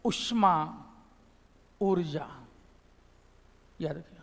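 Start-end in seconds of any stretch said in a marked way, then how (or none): 0.0-2.3 उष्मा and ऊर्जा said slowly and low; careful model of उष्मा, ऊर्जा; correct pronunciation. Shown as a demonstration. Teacher modelling the right words, careful. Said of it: "सही क्या है".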